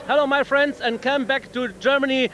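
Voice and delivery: normal talking voice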